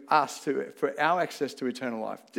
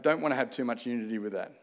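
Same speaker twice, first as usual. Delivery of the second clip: ordinary delivery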